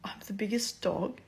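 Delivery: hushed imitation of a puzzled teacher; taken-aback question. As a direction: quietly query it, as if affronted. Teacher questioning the odd remark.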